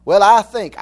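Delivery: loud mock opener of a know-it-all. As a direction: imitate someone starting a lecture. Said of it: "I hear Charlie Brown's teacher".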